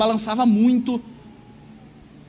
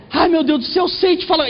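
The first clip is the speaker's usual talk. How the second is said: mock the mother feeling faint; mother in the shaking plane, high panicked cries; 6 semitones higher than usual. Mother begging.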